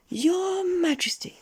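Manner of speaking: very high, slow squeaky announcement; announcing goblin. A narrator's reading. Goblin bowing to his king, slow high announcement. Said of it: "announced the goblin".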